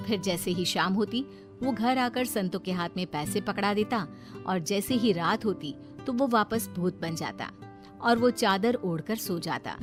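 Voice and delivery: plain reading voice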